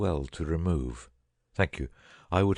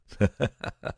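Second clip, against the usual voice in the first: chuckle; 2 semitones higher than usual. Acted. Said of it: "remarked my friend with a chuckle".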